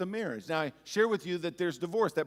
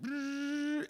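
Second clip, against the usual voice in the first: monitor flatline; 11 semitones higher than usual. Retold or imitated. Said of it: "and it just went".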